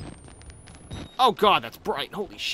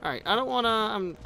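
ordinary delivery

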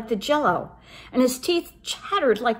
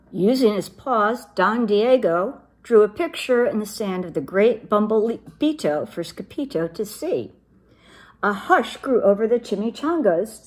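ordinary delivery, nothing marked

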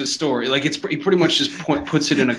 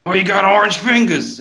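higher, put-on accusing voice; 7 semitones higher than usual. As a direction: exclaim it in a cartoon voice. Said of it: "And he's like".

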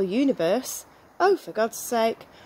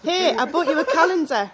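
louder, giggling; stifled giggles; 6 semitones higher, 4 dB louder than usual. Real, not acted. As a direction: hold back the giggles. Giggling at own joke.

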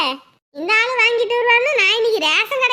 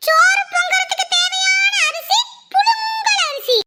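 high, slow, drawn-out shout spelling out புழுங்கல்; exasperated shouting; 9 semitones higher, slower than usual. Acted. Wife spelling it out for drunk husband, slow exasperated shout. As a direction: yell it slowly, word by word, stretching புழுங்கல்.

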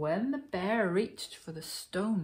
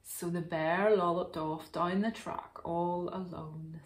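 usual speaking voice

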